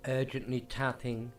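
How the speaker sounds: ordinary delivery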